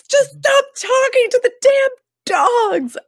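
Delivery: high, slow, strained mock outburst; hammed-up last-straw wail; mother snapping about the dogs, a high teary mock cry; mock exasperated cry. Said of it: "And she's like".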